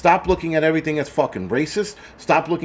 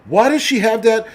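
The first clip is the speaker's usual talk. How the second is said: high, louder alarmed question; alarmed dad; 7 semitones higher, 4 dB louder than usual. Retold or imitated. Streamer retells seeing daughter's rainbow shirt, high alarmed outburst. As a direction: play up the dad's shocked overreaction.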